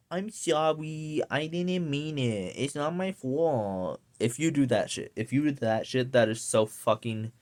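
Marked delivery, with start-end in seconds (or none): 0.1-4.0 put-on soft, slow whiny baby act; mocking a grown-up acting cutesy. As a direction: pout it out like a guilty toddler. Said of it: "if you act cutesy or like a baby".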